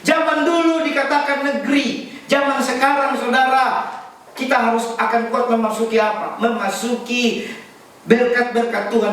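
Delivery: high, ringing preacher's voice; insistent sermon delivery, pitched well up; emphatic preaching. Real, not acted. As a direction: exhort the congregation at a high pitch.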